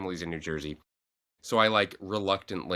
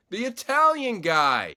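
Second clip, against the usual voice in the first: slow, higher, laughing dad voice; imitating dad; 5 dB louder, slower than usual. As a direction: draw it out like a clueless dad. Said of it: "he goes".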